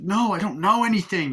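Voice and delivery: high, loud mock panic; a scared witness denies; hammed-up terrified denial; frightened denial.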